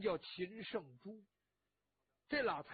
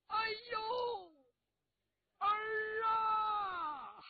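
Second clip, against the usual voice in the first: high, loud wailing cry of a mother; 13 semitones higher, 6 dB louder, much slower than usual. A narrator's reading. Mother behind the curtain recognises her lost nephew as son, wailing loudly. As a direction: sob out the word for son as a long-lost mother breaks down. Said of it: "有一个女人就哭了".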